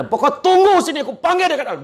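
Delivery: high, loud barking reply that only sounds angry; gruff, angry-sounding. Retold or imitated. Villager answering a visitor, gruff and angry-sounding. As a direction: snap it out like a cross villager who isn't really angry. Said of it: "Dia tak marah. Tapi dia cakap macam tu".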